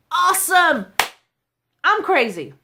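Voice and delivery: punched out loud; loud proud exclamation. Real, not acted. Speaker tells the audience she embraces being crazy, loud and proud. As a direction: belt it out with pride.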